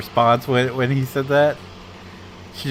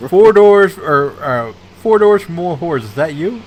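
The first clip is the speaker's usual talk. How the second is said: higher, louder, slow courtroom grilling; mock prosecutor; 5 semitones higher, 7 dB louder, slower than usual. Attorney reading the defendant's TikTok name to him, slow and loud. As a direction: lay the name out word by word for the jury. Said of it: "prosecutor questioning in court".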